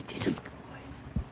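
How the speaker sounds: breathy whisper to the dog; whispered praise. Real, not acted. Speaker whispering praise to the dog, unvoiced. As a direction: whisper it like soothing a pet.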